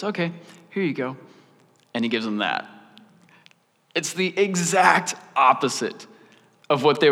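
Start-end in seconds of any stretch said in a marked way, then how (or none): 0.0-1.2 soft, offhand mock compliance; understated Paul. Paul answering the Corinthians' request, imitated with quiet compliance. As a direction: hand it over quietly, as if obliging. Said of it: "And he goes".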